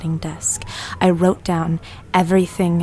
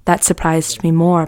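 ordinary talk, nothing marked

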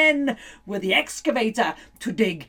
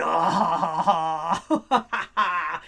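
witch cackle; 6 semitones lower than usual. Acted.

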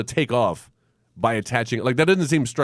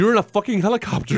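pitched up, incredulous jab; exasperated high yell; 9 semitones higher than usual. Retold or imitated. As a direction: throw it up high in disbelief. Exasperated mock yell at pilot.

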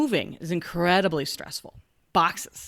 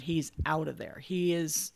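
ordinary delivery

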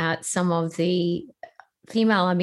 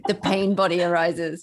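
laughing. Real, not acted. Teasing her about the stutter.

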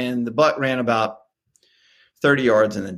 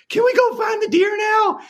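put-on excited child. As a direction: bounce with excitement like a kid. Dad voicing excited daughter.

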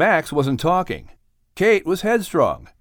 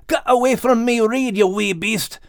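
growled at a crab; 8 semitones higher than usual. A narrator's reading. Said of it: "he growled".

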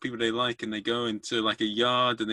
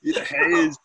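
loud, higher, hammed-up tough-guy accent; bad Terminator voice; 7 semitones higher, 7 dB louder, slower than usual. Imitating a date at the zoo for his co-host, bad Terminator voice. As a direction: ham up a badly done Terminator accent. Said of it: "that's your Terminator voice".

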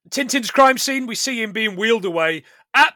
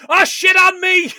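higher, louder, slow mock yell; shocked villain yell; 11 semitones higher, 9 dB louder than usual. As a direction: yell it like a shot villain, slowly. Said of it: "expression here is".

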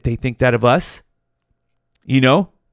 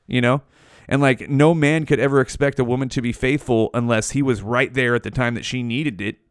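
normal talking voice

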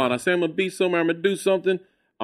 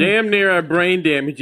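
usual speaking voice

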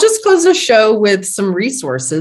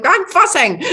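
high, loud laughing whine; laughing mock complaint; 9 semitones higher, 3 dB louder than usual. Answering God's advice with a high laughing protest. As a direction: admit it with a giggle like a sulky child. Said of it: "And I'm like".

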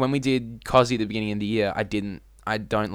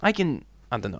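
ordinary delivery

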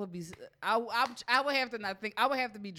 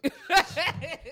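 burst of laughter; 8 semitones higher, 8 dB louder than usual. Real, not acted. Laughing after dirty talk.

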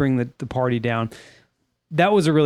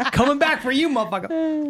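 mock menacing cat; 11 semitones higher than usual. Voicing the cat's threat.

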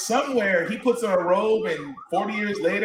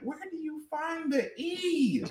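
incredulous quiet question; 11 semitones higher, 9 dB quieter, slower than usual. Retold or imitated. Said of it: "I'm like".

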